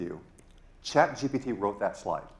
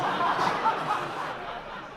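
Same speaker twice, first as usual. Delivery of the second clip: giggle; 3 dB quieter than usual. Real, not acted. Laughing at own joke.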